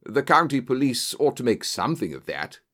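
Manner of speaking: plain reading voice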